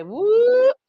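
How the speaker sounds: high, loud little whoop; playful whoop. Retold or imitated. Answering the ancestors with a quick, high, loud whoop. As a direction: yelp it like an eager reply. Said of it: "You say".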